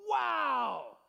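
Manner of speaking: loud, high, amazed shout; amazed wow. Shown as a demonstration. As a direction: shout it in wonder like Mary. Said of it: "you're gonna do what Mary did and you're gonna go".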